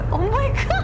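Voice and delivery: high laughing exclamation. Real, not acted. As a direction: laugh through the words. Laughing at crash.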